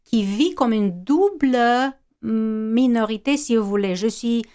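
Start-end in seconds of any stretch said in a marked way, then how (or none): none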